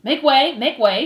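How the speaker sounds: shouted. Acted. Furlow clears the crowd, shouted. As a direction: shout ahead to clear a path. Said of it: "shouted Furlow".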